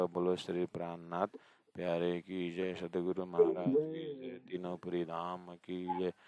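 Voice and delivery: rousing praise chant, line by line; devotional jai calls. Acted. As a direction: call out each jai to the congregation. Leads devotional victory calls. (सत्संग में जयकारा लगाना)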